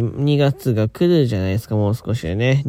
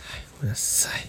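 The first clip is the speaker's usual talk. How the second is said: soft whispered apology; 10 dB quieter, faster than usual. Real, not acted. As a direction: whisper it sheepishly. Quiet apology on waking. (ささやき声)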